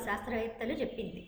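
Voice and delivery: ordinary talk, nothing marked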